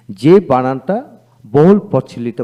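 ordinary talk, nothing marked

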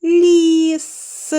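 Ліс loud, each sound clear; Ліс called out clearly for children to echo; said together. Shown as a demonstration. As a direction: model the word for the class. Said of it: "Вимовимо це слово разом".

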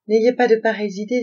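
s'écrie plus fort, pressante. A narrator's reading. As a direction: coupe court à ces idées noires d'un cri. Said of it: "s'écria Jeune Saule". (s'écrie plus fort pour la rassurer)

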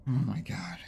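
breathy exhausted whisper. Real, not acted. Worn-out player after the chase, whispering in exhaustion. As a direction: whisper it, drained.

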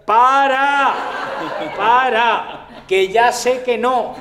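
drawn-out, high, loud yell; desperate halt. Acted. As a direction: stop them with a long pleading shout. Third acted halt. (grito largo y agudo de súplica)

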